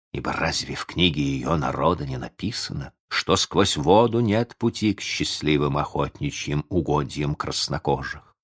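plain reading voice

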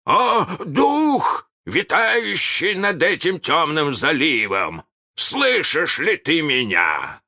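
high, slow calling out; drawn-out hail across the water; uncertain shout. A narrator's reading. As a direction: summon the spirit loudly but unsurely. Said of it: "Крюк закричал, но голос его звучал неуверенно".

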